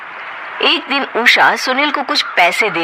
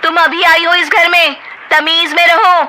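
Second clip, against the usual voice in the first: heated quarrel; 9 semitones higher than usual. Acted. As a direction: snap back and forth. Sisters-in-law quarrel.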